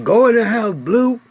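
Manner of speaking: put-on high, loud command to the boy; father yelling at his son to get inside; urgent order. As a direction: shout him back indoors.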